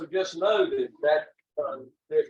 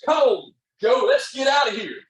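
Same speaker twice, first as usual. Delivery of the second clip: put-on much higher and louder, a frantic child shouting to his brother; panicked kid yell; 9 semitones higher, 6 dB louder than usual. Boy grabbing little brother to flee, panicked yell. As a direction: yell like a terrified boy bolting.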